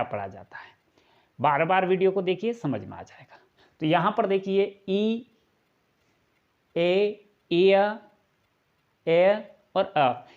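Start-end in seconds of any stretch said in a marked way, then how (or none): 4.9-10.3 ई, ए, इय, ए, अ spaced out as separate sounds; sound-by-sound demo. Shown as a demonstration. Teacher showing the readings of EA, sound-by-sound demo. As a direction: isolate each vowel with a pause, इय gliding "ee-uh". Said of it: "ये जो ईए का उच्चारण है".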